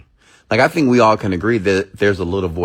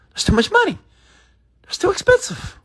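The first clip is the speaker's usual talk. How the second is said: much higher, squeaky put-on voice of the shocked inner thought; high mock inner voice. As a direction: squeal it like a stingy little voice in your head. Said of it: "You're like".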